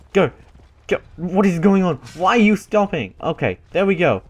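ordinary talk, nothing marked

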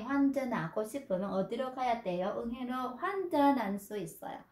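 환전하고 spoken quickly with the ㅎ smoothed away; fast native Korean. Shown as a demonstration. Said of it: "Chứ người ta không có là".

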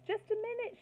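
high shout back. A narrator's reading. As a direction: yell it back from the room. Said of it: "shouted Bertie".

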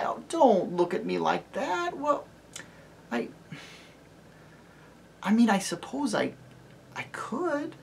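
high voice trailing into a whisper; high hesitant child; faltering girl. Acted. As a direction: give in, barely audible. Lucy faltering.

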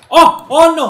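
high, loud yelps of alarm; panicked cry. Real, not acted. Player in trouble mid-fight, panicked cry. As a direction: cry out as it all goes wrong.

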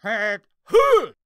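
loud, high barked drill call of Tent Hut; best first sergeant impression. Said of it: "I gave my best rendition".

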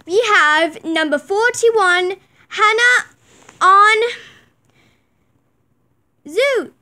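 slow, loud show announcer. Pretend. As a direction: call out the placings like a ringmaster. Announcing the placings.

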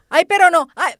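mock anguish at the scene; loud dismayed cries. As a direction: cry out in dismay. Distressed exclamation about dog.

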